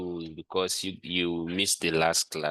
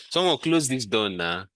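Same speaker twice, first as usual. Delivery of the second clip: calling out; 6 semitones higher, 5 dB louder than usual. Real, not acted. Teacher calls out over noise.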